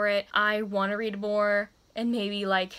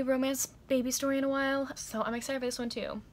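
ordinary talk

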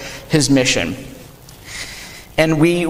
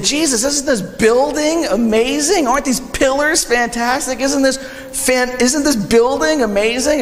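higher, gushing over the building; awed admirers; 6 semitones higher than usual. Retold or imitated. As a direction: playfully mimic wide-eyed admiration. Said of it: "the people following who said".

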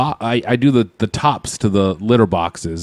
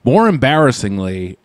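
normal talking voice

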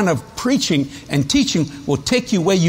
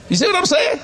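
higher, laughing shout; 12 semitones higher than usual. Real, not acted. Preacher laughing to the host about his guests, laughing shout. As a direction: burst out laughing as you ask.

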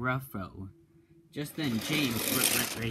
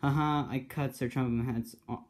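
ordinary delivery, nothing marked

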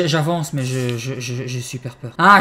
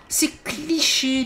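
mocking drawl; 10 semitones higher, much slower than usual. Mocking the game's sheets.

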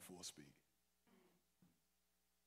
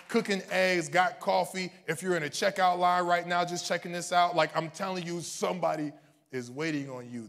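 loud, high, driving sermon climax; preaching at full force; 5 semitones higher, 11 dB louder than usual. Real, not acted. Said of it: "Okay, I'm preaching too long".

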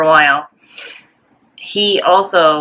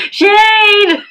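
laughing shout of shade; 5 dB louder than usual. Real, not acted. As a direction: call it out with a laugh. Sister teases, calls out shade.

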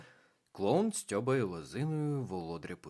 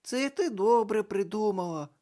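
put-on higher, louder girlish exclamation; exclaiming; 12 semitones higher, 7 dB louder than usual. A narrator's reading. Карнавальна баба Яга вигукує, високо й голосно. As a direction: cry out amused. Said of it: "вигукнула карнавальна баба Яга".

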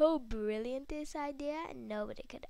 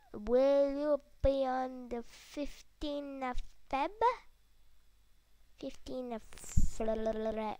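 slow drawn out; 6 dB louder, slower than usual. Real, not acted. Reading slowly.

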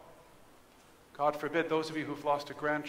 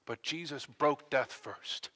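ordinary talk, nothing marked

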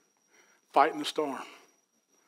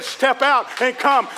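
slow, loud, deliberate call; God's invitation; 8 semitones higher, 5 dB louder, slower than usual. Retold or imitated. God calling Peter out of the boat, slow and loud. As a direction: draw each word out like a command. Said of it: "And God said".

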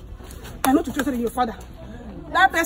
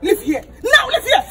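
laughing order; 9 semitones higher than usual. Acted. Shouting while laughing in disbelief.

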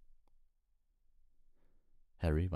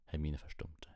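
plain reading voice

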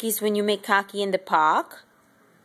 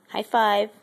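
louder, clipped command to the pet; 4 dB louder than usual. Real, not acted. Asking pet for high five.

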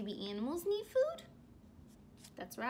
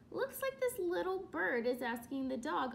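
ordinary talk

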